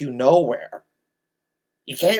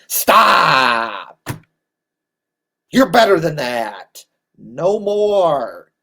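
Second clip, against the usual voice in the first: stern scolding; 7 dB louder, much slower than usual. Real, not acted. Scolding fans after mockery.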